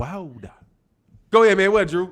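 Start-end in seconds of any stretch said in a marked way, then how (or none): 0.0-0.5 Wilder said low and soft with a dropped L; muttered, much lower Wilder; mock pronunciation. Mocking how people say Wilder, low and soft. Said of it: "People be like".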